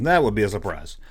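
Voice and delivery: ordinary talk, nothing marked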